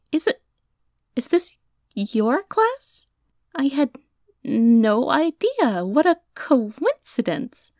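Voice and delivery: high, slow, drawn-out mock surprise; fake innocence. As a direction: play dumb, stretch the words. Pretending not to know.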